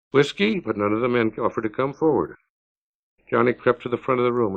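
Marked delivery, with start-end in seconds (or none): 0.1-0.6 high, rising bird-like call; high bird-call plea. Acted. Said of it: "It was like a bird call".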